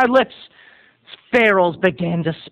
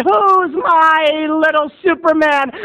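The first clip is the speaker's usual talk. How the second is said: higher, slow, louder coaxing mom; hammed-up proud mother; doting mom, drawn out; 7 semitones higher, 3 dB louder, slower than usual. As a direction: stretch it out to get the boy cheering. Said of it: "And Mom said".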